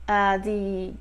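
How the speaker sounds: usual speaking voice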